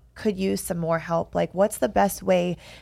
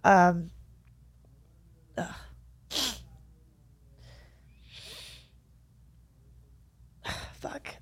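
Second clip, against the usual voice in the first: heavy sigh, fighting tears; overwhelmed, barely holding it together; choked up; 3 semitones lower, 9 dB quieter, slower than usual. Real, not acted. Overcome with emotion, sighs.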